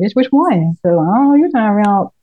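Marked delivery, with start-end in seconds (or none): 0.1-0.8 sassy retort. Retold or imitated. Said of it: "I was like".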